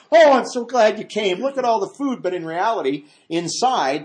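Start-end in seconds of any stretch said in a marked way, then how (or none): 0.1-2.2 high, loud, mock-delighted mom; fake gushing hostess. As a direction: gush at guests with put-on joy. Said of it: "I'm sure mom's acting".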